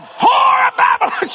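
very high, drawn-out accusing cry; hammed-up prophet bellowing judgment; shouted denunciation. Man denounces the woman before the plane, shouting denunciation.

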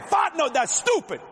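high-pitched Cajun exasperation; exasperated scolding. Acted. Boudreaux scolding Fontenot over the marked boat, exasperated. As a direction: burst out at your foolish fishing buddy.

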